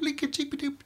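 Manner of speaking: high chirpy canary cheeps; canary impression. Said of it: "which is my canary impression".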